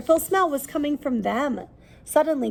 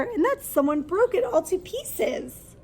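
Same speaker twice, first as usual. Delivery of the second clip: squeaked; 7 semitones higher than usual. Acted. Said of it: "squeaked Baby Bear".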